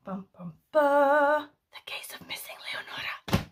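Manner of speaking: hushed, spooky whispered title sting; mock-ominous whispered announcement of the case; dramatic whisper. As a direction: whisper it like a mystery trailer.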